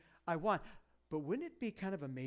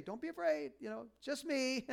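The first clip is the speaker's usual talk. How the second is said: high mock angel voice; 8 semitones higher than usual. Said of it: "they always say".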